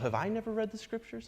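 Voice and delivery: high, softened question to himself; self-questioning. Acted. Pastor thinking to himself after reading the verse, self-questioning. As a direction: ask it inwardly, pitched well up. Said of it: "I think to myself".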